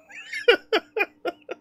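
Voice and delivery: high-pitched burst of laughter. Real, not acted. Laughing at the donation.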